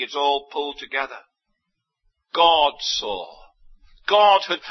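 ordinary talk, nothing marked

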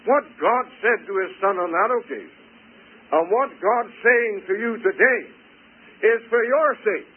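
emphatic pulpit appeal, pitched high; fervent preaching. Real, not acted. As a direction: drive it home to the congregation, building in pitch and volume. Preaches with rising force.

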